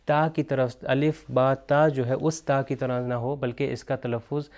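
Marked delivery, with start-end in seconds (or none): none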